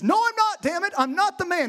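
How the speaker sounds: high, heated outburst; angry denial. Acted. Peter cursing at his accusers, angrily defensive. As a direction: blow up and deny it. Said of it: "he gets angry and he says".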